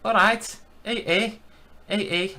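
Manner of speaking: high, put-on mock Scouse; squeaky-high fake Liverpool accent; attempted Scouse accent. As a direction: have a bad go at Scouse. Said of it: "Can I do a Scouse accent?".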